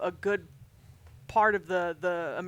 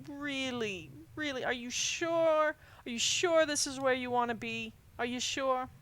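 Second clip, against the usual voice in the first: soft, higher, weepy mother; choked-up mom; 3 semitones higher, 6 dB quieter than usual. Acted. Weepy mom seeing her son off to camp, choked up. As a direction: fret tearfully and softly, drawing out the worry. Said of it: "She's a little choked up".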